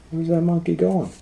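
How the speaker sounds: a small, high, wondering mouse voice; curious mice. Acted. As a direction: ask it like a tiny mouse peeking.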